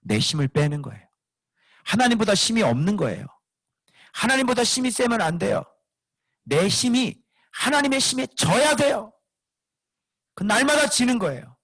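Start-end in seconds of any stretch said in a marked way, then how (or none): 1.8-11.6 much higher, pressing preacher's voice; preaching that we must lose to God, much higher; full-force preaching. Acted. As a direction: drive the point home from the pulpit.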